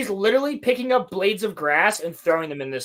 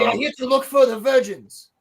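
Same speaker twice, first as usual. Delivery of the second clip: high-pitched mock learner-English accent; Soul's accent; 5 semitones higher, 4 dB louder than usual. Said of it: "it sounds like he's saying virgins".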